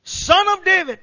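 loud, high call to Jesus; crying out. A narrator's reading. Blind men begging Jesus for mercy, crying out. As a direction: call it out like a plea from afar. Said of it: "crying out, saying".

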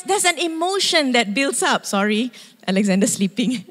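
usual speaking voice

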